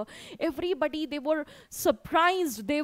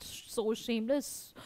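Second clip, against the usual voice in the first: hushed, low, drawn-out murmur; soft, low scandalised aside; imitated guests' whisper; 4 semitones lower, 9 dB quieter, slower than usual. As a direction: gossip under your breath, slowly. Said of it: "Obviously, the guests are like that".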